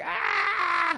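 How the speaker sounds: put-on very high, piercing baby wail; baby's cry. Comic as the landlord's baby downstairs, high wail. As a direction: imitate a screaming baby. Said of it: "the baby's like".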